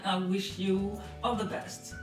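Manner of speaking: usual speaking voice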